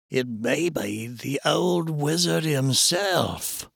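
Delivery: slow, drawn-out, foreboding delivery; ominous character voice. A narrator's reading. Man guessing the old wizard is watching, ominous. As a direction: stretch the words out with dread.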